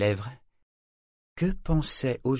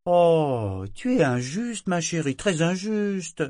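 high, louder indignant protest; energetic protest; 8 semitones higher, 4 dB louder, slower than usual. A narrator's reading. Figurehead defending herself to the sailor, high and energetic. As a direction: protest it with spirit. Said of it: "protesta Ophélie avec énergie".

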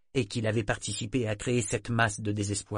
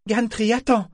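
much higher and louder urgent call; 12 semitones higher, 8 dB louder than usual. A narrator's reading. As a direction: call him back before he leaves. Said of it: "fit-elle d'un ton pressant".

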